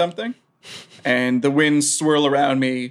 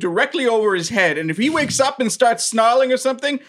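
character voice; 8 semitones higher than usual. Acted. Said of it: "bring them back and say".

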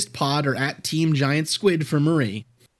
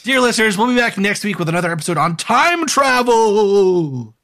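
announcer voice; 5 semitones higher, 6 dB louder than usual. Acted. Podcast outro announcement.

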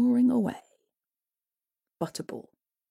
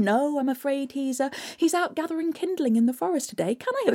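mother; 7 semitones higher, faster than usual. Acted. Mother fibs to the troll hag, high and nervous. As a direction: cover for your son.

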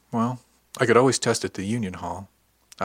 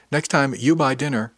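louder, higher call to someone leaving; calling after her; 5 semitones higher, 5 dB louder than usual. Acted. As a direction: toss it after her as she goes. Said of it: "Captain Gigon called after her".

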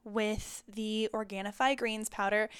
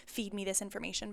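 ordinary talk, nothing marked

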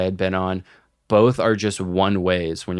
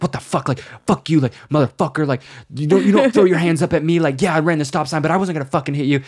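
high, fast furious ranting; retold rage; 7 semitones higher, much faster than usual. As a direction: rant at the other drivers in a fury. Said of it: "cussing to myself".

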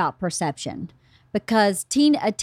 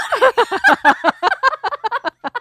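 high squealing laugh; high laughter; 12 semitones higher, 5 dB louder than usual. Real, not acted. Laughing at daughter's worried question, high.

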